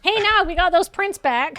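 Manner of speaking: high-pitched, lively report; chipper colleague character. Acted. Colleague tells Nog the prints are back, high lively voice.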